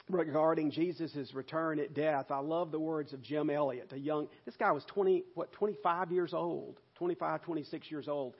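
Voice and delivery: ordinary talk, nothing marked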